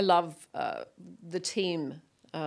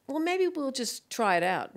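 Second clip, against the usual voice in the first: slow, higher-pitched humouring tone; tentative coaxing; 7 semitones higher, slower than usual. Retold or imitated. Mother humouring her son about his new name, slow coaxing. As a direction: gently talk a small child round. Parent humouring child in story.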